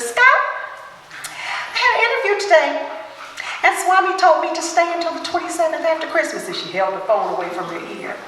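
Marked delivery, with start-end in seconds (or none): 0.0-6.5 high nasally voice. Retold or imitated. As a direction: rush it out in her high nasal voice. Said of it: "she has this high, nasally pitched voice".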